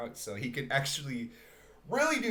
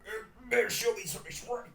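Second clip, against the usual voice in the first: drunken, higher put-on cartoon voice; Rick; 8 semitones higher than usual. Actor doing his drunk Rick voice to demand respect. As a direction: slur it out like a burping drunk. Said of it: "he's, like, doing Rick impressions".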